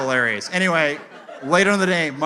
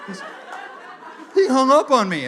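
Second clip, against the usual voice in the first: high-pitched imitation of an affronted brother; incredulous complaint; 8 semitones higher than usual. Brother reports that dad hung up on him, mock incredulous. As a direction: protest high in mock disbelief.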